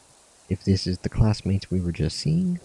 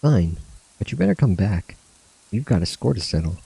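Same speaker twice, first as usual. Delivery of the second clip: lightly acted friends saying goodbye, a bit higher; voicing game characters; 3 semitones higher, 3 dB louder than usual. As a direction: give the farewell banter a light acted read, slightly raised pitch. Reads farewell scene dialogue.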